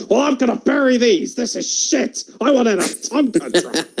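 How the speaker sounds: put-on spoiled child. Little Vince dismisses his dad's gift, whiny kid. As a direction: sulk like a bratty boy.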